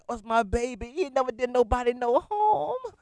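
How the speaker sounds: a hint of grandma defending her baby; pleading grandma. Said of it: "She cannot co-sign".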